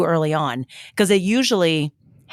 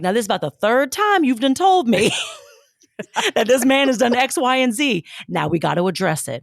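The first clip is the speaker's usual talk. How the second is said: auntie voice; 5 semitones higher than usual. Retold or imitated. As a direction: lay it down like a no-nonsense auntie. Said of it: "Victoria's got her auntie voice on".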